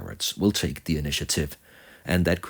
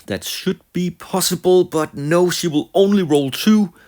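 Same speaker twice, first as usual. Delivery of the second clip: high, tense play-by-play, pushed louder; excited commentary; 11 semitones higher, 6 dB louder than usual. Real, not acted. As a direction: call the roll in high-pitched alarm. Suspense turns to dismay at roll.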